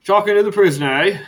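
high, loud knowing question; a nosy guard checking; doorman's voice. Acted. Doorman checks the party wants the prisoner, high and loud.

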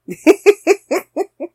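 loud high burst of laughter; big laugh. Real, not acted. Laughing at her own bragging.

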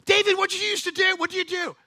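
high, loud demanding question; pressing king. Acted. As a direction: push him for an answer. Saul presses David.

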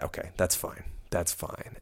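hushed, soft self-reassurance; whispered to self. Retold or imitated. Thinking to himself as the wine is poured, whispered. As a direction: talk yourself down under your breath.

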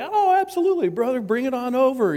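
high, bouncy eager yes; eager little brother. Retold or imitated. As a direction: jump at the favor, pitch way up.

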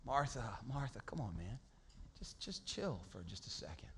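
calm her down gently; Jesus calming a stressed Martha; soothing Jesus. Retold or imitated.

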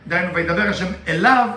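ordinary talk

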